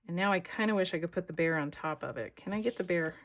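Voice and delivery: normal talking voice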